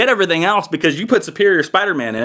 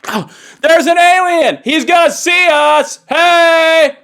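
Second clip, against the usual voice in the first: high, loud shouting of someone gone deaf; can't control volume; 13 semitones higher, 6 dB louder, slower than usual. Acted. Deafened Captain America answering his teammate, oblivious shouting. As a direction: blare cheerful small talk far too loud. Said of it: "can't control the volume of his voice".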